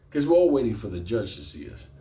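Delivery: ordinary talk, nothing marked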